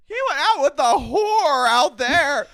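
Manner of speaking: very high, slow, loud screech; mock scream. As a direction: screech it out as a hammed-up imitation. Re-enacts Katie's screamed outburst.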